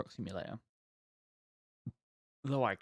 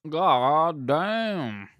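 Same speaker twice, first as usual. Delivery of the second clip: God damn drawn out, higher and louder, damn falling; hammed-up curse; 4 semitones higher, 7 dB louder than usual. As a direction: stretch damn into a long theatrical groan. Repeats curse after coughing.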